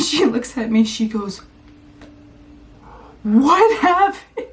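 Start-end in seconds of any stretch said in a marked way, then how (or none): 3.2-4.5 slow, louder, higher alarmed mom. Retold or imitated. Mom seeing her daughter's nose, slow alarmed question. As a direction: stretch it out in shock.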